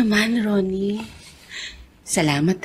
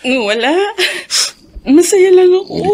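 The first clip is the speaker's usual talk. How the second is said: tearful joy; 4 semitones higher, 5 dB louder than usual. Acted. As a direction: voice rising, close to tears. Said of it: "gusto mo pang umiyak". (naiiyak sa tuwa ang asawa)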